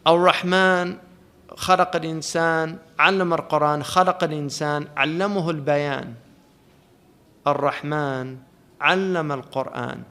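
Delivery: quick, rhythmic recitation of the Arabic verses; Quran recitation. Acted. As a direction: recite it like a verse from memory, flowing fast.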